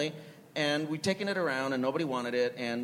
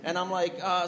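usual speaking voice

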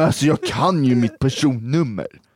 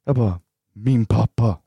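ordinary talk, nothing marked

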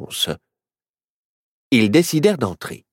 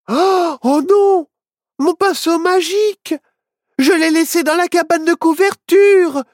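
high, loud, panicked oh no; dismayed; 5 dB louder than usual. Acted. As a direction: realise the brush is forgotten. Witch forgot her magic brush. (panique, voix très aiguë)

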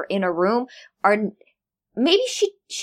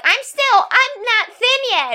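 much higher, slow, mock confession; high mock caller voice; 12 semitones higher, slower than usual. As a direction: play a kid putting on a silly caller voice. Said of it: "say, like".

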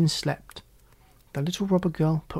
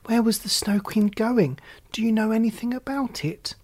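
eager question; 6 semitones higher than usual. A narrator's reading. Gerda eagerly asks the pigeons where the Snow Queen went. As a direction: ask it with anxious hope.

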